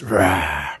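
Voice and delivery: growling zombie groan. Shown as a demonstration. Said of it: "You go like this".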